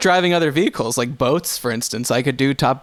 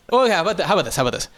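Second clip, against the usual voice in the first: normal talking voice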